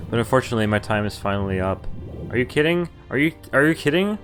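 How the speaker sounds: usual speaking voice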